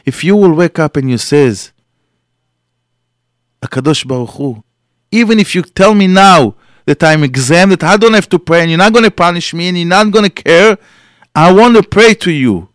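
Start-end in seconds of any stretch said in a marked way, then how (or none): 3.6-12.7 high, loud impassioned plea to God; fervent declaration. Acted. A devoted man telling God he wants to pray, fervent and loud. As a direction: insist with rising passion you want to pray.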